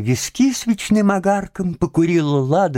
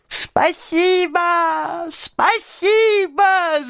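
very high, long-drawn squeal of thanks; piercingly high, slow little creature voice; squeaky imp cry; much slower than usual. A narrator's reading. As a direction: squeak it out, stretching every syllable. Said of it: "закричал он пискляво".

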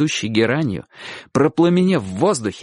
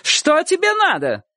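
high, stretched indignant cry; shouting. A narrator's reading. Aunt confronting dog-catcher from upstairs, shouting. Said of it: "кричала она".

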